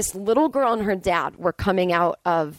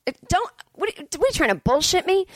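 high, squeaky indignant voice; high sputtering outrage at the con; outraged disbelief; 10 semitones higher than usual. Real, not acted. Outraged at scammer.

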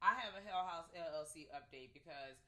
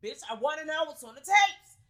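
loud, high excited yell; excited shout; 10 semitones higher, 21 dB louder than usual. Real, not acted. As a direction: burst out dying to know. Excited outburst about the tapes.

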